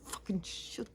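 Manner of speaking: high, strained imitation of a crying rant; re-enacted tearful meltdown, voice thin and high; tearful cursing fit. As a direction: act out cursing through tears, pitch way up. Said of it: "before I started with my tears coming".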